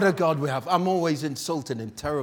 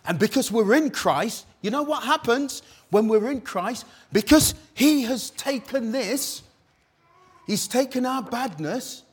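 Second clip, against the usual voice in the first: high, loud, rising sermon climb; full-force preaching; 8 semitones higher, 8 dB louder than usual. Acted. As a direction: preach it out, building to a peak.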